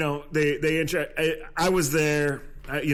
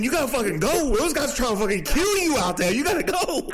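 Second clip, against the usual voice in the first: mock-pumped Texas player, a hint of the accent, climbing higher; 8 semitones higher than usual. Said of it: "He had a deep Texas accent".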